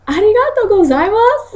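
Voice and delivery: "Arigato gozaimasu" pitched high, laughing through the mock thanks; a high, giggly, sarcastic arigato. Thanking the dorm parent sarcastically for the jab, high and laughing. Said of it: "in a sarcastic way".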